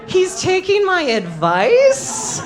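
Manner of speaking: high, slow dreamy swoon; sing-song high lovestruck gushing; mock swoon. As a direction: gush like a lovestruck fool. Swooning over a date.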